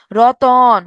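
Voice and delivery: রতন stretched, with a long open vowel before the final ন; drawn-out pronunciation. Shown as a demonstration. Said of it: "এই উচ্চারণ করেন তো".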